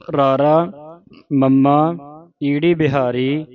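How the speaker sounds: letter by letter with pauses, each rising until the last falls; ਰਾਰਾ, ਮੰਮਾ, ਈੜੀ ਬਿਹਾਰੀ, ਈੜੀ ਲਾਂ set apart; spelling it out. Shown as a demonstration. Teacher spelling the word for learners, slow and separated. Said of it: "ਇਸ ਦਾ ਉਚਾਰਨ ਕਿਵੇਂ ਹੈ".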